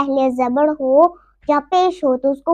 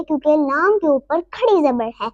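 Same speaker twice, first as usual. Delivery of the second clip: ordinary talk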